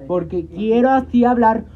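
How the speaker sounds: loud, slowed, stubborn declaration; speaking loud. Shown as a demonstration. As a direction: talk loud just to prove you can. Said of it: "hablar fuerte".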